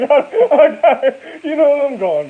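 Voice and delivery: loud laugh through slow words. Real, not acted. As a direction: laugh it out while talking.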